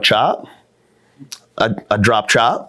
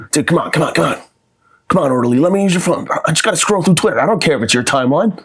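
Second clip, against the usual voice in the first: higher, whiny pleading for the phone; begging addict; 6 semitones higher than usual. Acted. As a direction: beg the orderly like a desperate addict. Begging the orderly in rehab.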